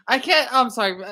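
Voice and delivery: ordinary talk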